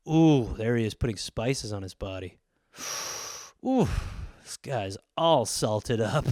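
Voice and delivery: low, soft, hungry coo; drooling cannibal. Pretend. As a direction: savor every word. Cannibal drooling over photos.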